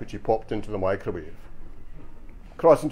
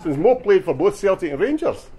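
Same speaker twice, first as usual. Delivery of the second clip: raised, emphatic, innocent-sounding reasoning; straight-faced suggestion; 8 semitones higher, 5 dB louder than usual. Acted. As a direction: press the cheeky point as if serious. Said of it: "says me with a straight face".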